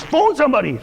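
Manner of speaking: sharp high mock yell for help; manager shouting. Panicked manager to his staff, mock yell. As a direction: bark the order like a panicked boss. Manager yelling during a breakdown.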